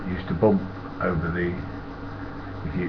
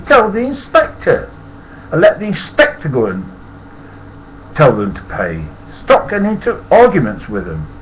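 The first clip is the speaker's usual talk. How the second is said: stern telling-off; 8 semitones higher, 6 dB louder than usual. Retold or imitated. Lecturing the conductor sternly.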